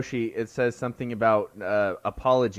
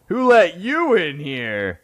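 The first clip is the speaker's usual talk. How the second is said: corny dad voice; 6 semitones higher, 7 dB louder, slower than usual. Retold or imitated. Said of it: "they go".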